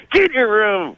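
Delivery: high-pitched imitation of an angry mother; scolding mom. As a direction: send the kid off in a put-on high mom voice. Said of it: "She's like".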